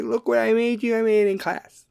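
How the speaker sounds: put-on high, bouncing child voice; excited kid. A child showing dad a drawing, high and thrilled. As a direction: jump up and down with glee. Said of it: "they're super excited and they're jumping".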